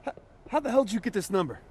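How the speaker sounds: shocked outburst. Acted. Delsin shocked Hank has his number, fast startled disbelief. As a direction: blurt it out in disbelief.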